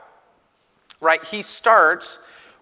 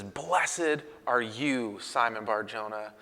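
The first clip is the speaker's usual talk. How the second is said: softer and slow, tender and reverent; solemn blessing; 4 semitones lower, 9 dB quieter, slower than usual. Retold or imitated. Jesus blessing Peter, soft, slow and tender. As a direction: lay the blessing gently on him.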